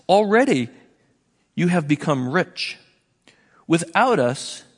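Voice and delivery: mock their spiritual pride, slowing at the end; mocking sarcasm. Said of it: "So he starts mocking".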